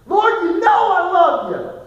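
high, loud, slow pleading cry; anguished cry. Acted. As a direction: cry it out as if your heart breaks. Peter's anguished third answer.

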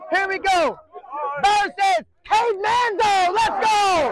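high shouted announcement; coach's matchup call. Real, not acted. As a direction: yell out the next matchup to the team. Coach calling next matchup.

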